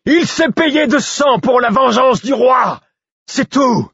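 high, loud and slow proclamation; defiant shout. A narrator's reading. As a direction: hurl it at the crowd of guards. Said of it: "criai-je".

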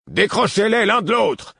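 high, loud bawled order; sergeant bawling. A narrator's reading. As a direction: bark the order over the crowd. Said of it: "brailla un sergent". (ordre braillé par un sergent)

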